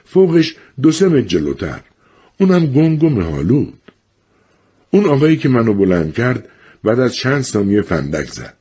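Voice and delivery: ordinary delivery, nothing marked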